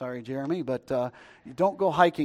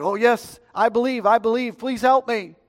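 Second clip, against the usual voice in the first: higher, louder acted begging; desperate pleading; 6 semitones higher, 6 dB louder than usual. Dangling hiker answers the voice from the sky, desperate. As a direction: plead urgently for rescue. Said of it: "And the man said".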